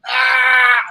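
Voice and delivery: loud, high yell of pain, imitated; pained shout. Biker hit by the truck, yelling in pain. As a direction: belt out the biker's yelp as he's hit. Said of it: "Dude's like".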